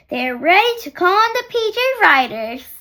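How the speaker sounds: plain reading voice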